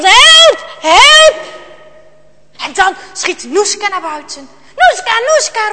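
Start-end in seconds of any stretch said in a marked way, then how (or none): 0.0-1.4 high, loud scream for help. A narrator's reading. Father cowering before the bear, screamed cry for help. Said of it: "Vader krimpt ineen en gilt". 4.8-5.7 high, urgent call to a dog. A narrator's reading. Jarno calls his dog Noeska, high urgent call. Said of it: "roept Jarno".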